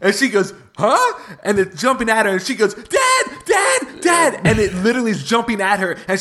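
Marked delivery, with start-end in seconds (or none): 0.8-1.4 put-on high, puzzled little-kid voice; mimicked daughter, pitched up; confused child. 2.7-4.4 very high mimicked child's cries; imitated panicking little girl; child calling for dad. Frightened child calling dad, retold.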